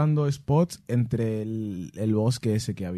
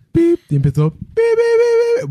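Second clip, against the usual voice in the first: high electronic beeps from the keypad; imitated beeps; 9 dB louder than usual. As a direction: chirp out the alarm beeps high. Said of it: "Hice así". (pitidos agudos de alarma imitados)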